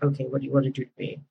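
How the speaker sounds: low, soft, quick mumble; giving in. Retold or imitated. Caller finally breaks and asks operator, quietly giving in. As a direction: imitate a caller dropping the act. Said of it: "he would break".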